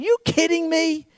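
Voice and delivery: loud, high, incredulous questioning; God's mocking astonishment, pitched right up; mocking disbelief. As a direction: scoff at a ridiculous accusation. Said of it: "He's laughing".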